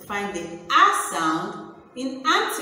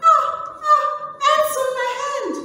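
play-acted fright, climbing higher with each cry; mock screaming; 13 semitones higher, faster than usual. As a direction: act out a small, squeaky scream of alarm. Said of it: "as she was screaming".

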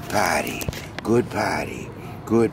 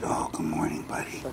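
soft good morning; 4 semitones lower, 10 dB quieter than usual. Shown as a demonstration. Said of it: "this is the way I say good morning".

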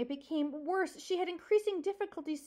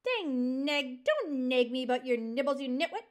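mildly bellowed irritation; 3 dB louder than usual. Acted. Porcupine sick of the fox's excuses, bellowing. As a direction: snub the excuse forcefully. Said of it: "Aerith bellowed".

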